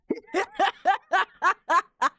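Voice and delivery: high loud cackle; burst of laughter. Real, not acted. Streamer laughing at his own rant: loud high laugh. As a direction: crack up laughing.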